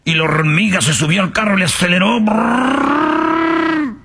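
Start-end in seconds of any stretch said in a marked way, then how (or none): 2.2-4.0 high revving brrr; car engine imitation; engine noise. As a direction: rev like a car.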